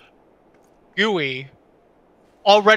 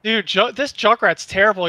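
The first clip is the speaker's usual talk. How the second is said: usual speaking voice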